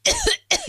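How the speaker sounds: a staged, hacking fake cough. Prank caller fakes a cough to sound ill, staged cough. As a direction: cough on purpose to sound sick. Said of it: "you can't even get through a sentence".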